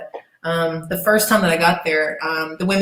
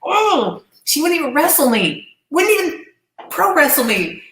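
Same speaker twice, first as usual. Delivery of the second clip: high-pitched, indignant griping; exasperated complaint; 8 semitones higher than usual. Retold or imitated. Snubbed wrestler complaining about the refused match, exasperated. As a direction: replay the frustration in a high, aggrieved voice.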